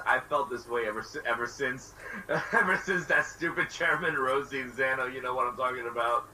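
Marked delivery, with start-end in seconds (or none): 1.2-5.1 laughing while complaining. Real, not acted. Laughs through annoyed complaint.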